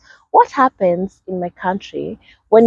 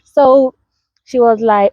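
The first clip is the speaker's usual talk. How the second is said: ordinary talk, nothing marked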